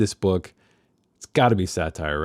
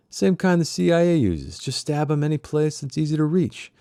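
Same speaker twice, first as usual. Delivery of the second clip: pitched-up storyteller voice; higher character voice; 7 semitones higher than usual. Acted. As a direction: lift it into a character voice. Fritz voiced in read novel.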